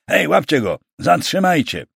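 urgent, high-pitched cry to seize someone; thundered order. A narrator's reading. Secretary ordering men to stop the knight, shouting. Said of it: "zagrzmiał sekretarz Oleśnickiego".

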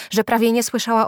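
ordinary delivery, nothing marked

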